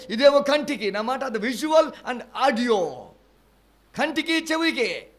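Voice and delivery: high, loud fiery preaching; preaching at full force. Acted. A preacher thundering about tongues of fire, loud and high. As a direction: proclaim it to the whole hall, loud and high.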